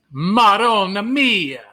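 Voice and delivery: high, loud, drawn-out Italian exclamation; big slow Italian-American lament; Italian accent. Shown as a demonstration. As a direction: wail it in a hammed-up Italian accent. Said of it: "Do I have to work on my Italian accent?".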